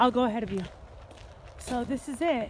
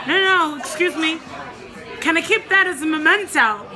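eager plea; 6 semitones higher, 6 dB louder than usual. Retold or imitated. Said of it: "the guy at the bar goes".

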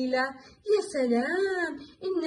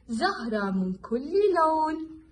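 ordinary talk

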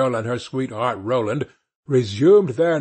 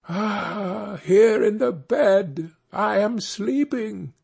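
higher, slow, sleepy ghostly voice; 6 semitones higher, slower than usual. A narrator's reading. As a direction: moan it like a sleepy spirit. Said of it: "cried the third drop of blood".

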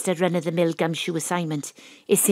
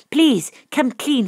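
louder, higher, slow plea; pleading; 6 semitones higher, 5 dB louder, slower than usual. Acted. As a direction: beg him with everything. Pleading with old friend.

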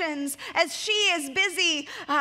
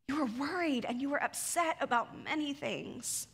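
compassionate; 6 dB quieter than usual. Shown as a demonstration. Jesus comforting a worried Martha, gentle. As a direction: say it like comforting a tired friend. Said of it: "the same Jesus that says, Come to me".

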